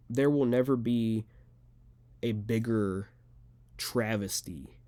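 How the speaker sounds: weighty pauses, landing slowly on "travesty"; emphatic TRAVESTY. Real, not acted. Fighter condemning the event to fans, "travesty" slow and emphatic. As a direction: pause before "travesty", then stretch it out slowly. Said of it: "I wanna emphasize that word".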